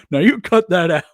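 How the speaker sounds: loud mock call from the other room; authoritarian parent. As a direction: scold without getting up. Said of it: "kind of like an authoritarian parent".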